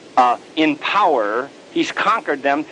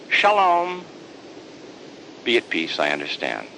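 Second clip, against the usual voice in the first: gentle Jesus; 3 semitones lower, 4 dB quieter than usual. Acted. Jesus gentle, fading softer.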